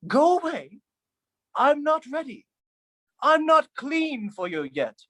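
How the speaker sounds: high, whiny mock plea. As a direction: voice jumps way up, pleading. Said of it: "The sink shrink says".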